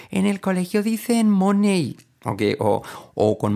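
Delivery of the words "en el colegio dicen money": high, slow imitation of a little kid; child's voice. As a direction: quote the kid playfully, pitched way up. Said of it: "como un niño me dijo".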